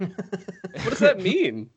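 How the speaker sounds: slow, laughing mock question; puzzled through a laugh.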